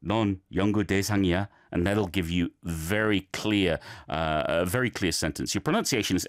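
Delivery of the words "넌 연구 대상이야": 넌 연구 대상이야 kept on one level; don't go up and down on 넌 연구 대상이야; fast, even-pitched model of 연구 대상이야; monotone. Shown as a demonstration. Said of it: "a monotone".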